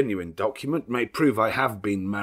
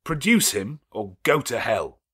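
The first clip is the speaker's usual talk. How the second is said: high, loud defiance; furious demand; 3 semitones higher, 7 dB louder than usual. Acted. Rochester dares the lawyer, furious loud demand. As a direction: spit out the dare.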